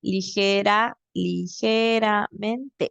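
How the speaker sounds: "ligeramente" drawn out slowly, the final e held long; teacher breaking the word down for the student, slowly; slow stressed demo. Shown as a demonstration. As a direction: stretch "ligeramente" to show its syllables. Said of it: "Y ligera, ligera".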